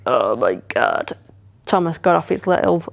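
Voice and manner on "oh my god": low, slow, stunned Oh my God; stunned gasp of pain. Retold or imitated. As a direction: let it drop out of you, winded by the pain. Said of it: "I was like".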